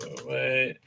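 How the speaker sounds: ordinary talk, nothing marked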